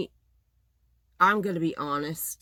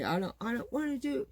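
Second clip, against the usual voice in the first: high, softer mock whine of a sulky man; whiny husband; 8 semitones higher, 4 dB quieter than usual. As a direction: imitate him whining like a kid. Mocking husband's refusal.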